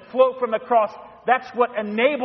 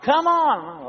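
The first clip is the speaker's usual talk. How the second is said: higher, coaxing encouragement to a lagging husband; coaxing wife; 9 semitones higher than usual. Retold or imitated. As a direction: cheer him on like a patient wife. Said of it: "Reaches out her hand".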